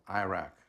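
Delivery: Iraq said "eye-RACK"; American-style Iraq. Shown as a demonstration. Speaker quotes the local way of saying it, Iraq as "eye-RACK". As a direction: say Iraq with a long "eye" at the start. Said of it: "as you say here".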